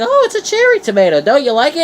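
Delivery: high, quick sweet grandma voice; high sing-song grandma offering a tomato; grandmother voice. Retold or imitated. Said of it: "She's like".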